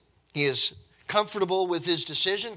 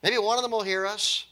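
high-pitched, any-god-will-do urging; raised voice of a superstitious captain; not-caring captain; 8 semitones higher, 3 dB louder than usual. Acted. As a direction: hand out orders to pray, grasping at luck. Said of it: "this captain, he's, he doesn't care".

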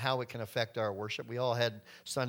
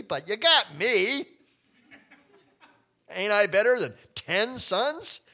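self-satisfied husband; 2 semitones higher, 5 dB louder than usual. Acted. Elkanah boasting to Hannah that he beats ten sons, loud and pleased. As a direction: puff up like the husband who thinks he's enough. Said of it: "Then he says".

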